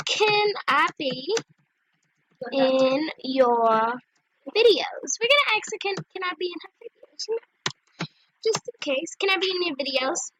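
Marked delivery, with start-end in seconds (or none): 0.1-5.1 very slow, stretched words; typing aloud. Real, not acted. Asking to be in her videos, typing aloud. Spelling out her chat message. 9.2-10.3 brisk, run-together question; quick read-back. Real, not acted. Checking the question for her videos, quick read-back.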